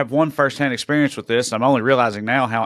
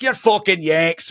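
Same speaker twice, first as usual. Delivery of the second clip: higher, slow mock Scottish accent; Scottish lassie; 4 semitones higher, slower than usual. Retelling the lassie's reply to Americans, Scottish lassie. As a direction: drag it out as a Scottish lass. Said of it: "And she's like".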